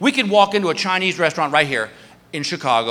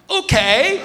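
tipsy agreement; 8 semitones higher than usual. Retold or imitated. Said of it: "I'm like".

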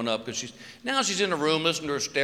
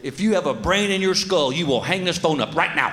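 louder, higher menacing warning; threatening dad; 5 semitones higher, 3 dB louder than usual. Retold or imitated. Dad warning a boy who called at 2am, loud threat. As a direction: scare the kid off the line.

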